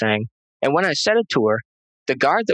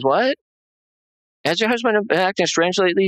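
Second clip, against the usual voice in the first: higher, bewildered echo of the question; baffled wife; 7 semitones higher than usual. Retold or imitated. Wife echoing the doctor's question, higher and bewildered. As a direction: repeat it as if you can't believe it.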